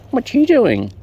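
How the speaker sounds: high and loud, sing-song at the bird; high baby talk. Acted. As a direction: coo loudly at the bird like a baby. Talking to birds.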